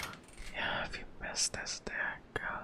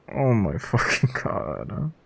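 laughing through embarrassment; embarrassed laughing; 4 semitones higher, slower than usual. Real, not acted. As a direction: laugh while saying it, mortified.

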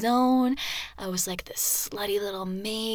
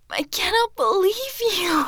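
high, louder, incredulous scolding; mock outrage; 9 semitones higher, 5 dB louder than usual. Girlfriend scolding her partner for laughing, mock outraged. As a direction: exclaim it high in playful indignation.

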